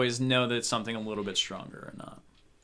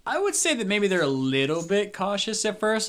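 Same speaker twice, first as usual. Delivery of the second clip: ordinary delivery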